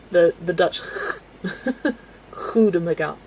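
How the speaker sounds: ordinary talk, nothing marked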